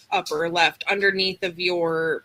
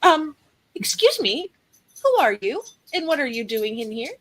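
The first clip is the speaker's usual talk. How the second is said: put-on higher-pitched older woman challenging an intruder; 6 semitones higher, faster than usual. Older woman confronting a stranger in her kitchen, indignant. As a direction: demand an explanation in a higher voice. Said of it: "there is an older woman".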